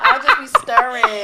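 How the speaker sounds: pretending to be busy, laughing; hammed-up busy waxer; stirring distracted. Shown as a demonstration. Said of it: "distracting my client like this".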